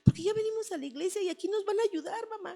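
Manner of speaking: put-on high, urgent child's voice begging his crying mother; little boy pleading. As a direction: play her scared son begging mom not to get hurt. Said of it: "y Diego me decía".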